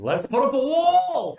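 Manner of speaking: high, loud joking holler; mock rallying shout. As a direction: yell it like a slogan, half laughing. Jokingly calls out a slogan.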